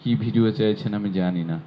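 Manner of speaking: usual speaking voice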